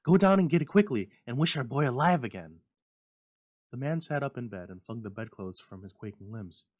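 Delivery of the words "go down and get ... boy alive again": urgent. Acted. Triumphant wife urging.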